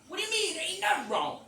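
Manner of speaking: high, loud indignant denial; retold loud protest. Speaker denying trouble to cop, loud and indignant. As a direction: protest loudly with pitch way up. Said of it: "he kinda get loud".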